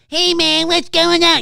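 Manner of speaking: high, loud, put-on goofy voice; odd exaggerated voice. Shown as a demonstration. Voice actor demonstrating a strange voice, high and loud. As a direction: show an unusual voice nobody really has. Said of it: "most people don't sound like that".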